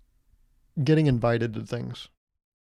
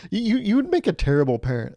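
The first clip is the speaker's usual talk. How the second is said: higher, louder stinging insult; indignant jab; 7 semitones higher, 3 dB louder than usual. Retold or imitated. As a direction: jab him where it hurts. Insulting the manager.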